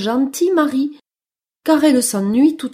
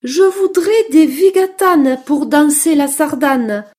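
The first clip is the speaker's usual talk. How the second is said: higher, louder mouse voice; little mouse asking; 4 semitones higher, 6 dB louder than usual. Acted. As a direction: make a keen mouse's request. Mouse asks bear for shoes. (petite souris qui demande, voix plus aiguë)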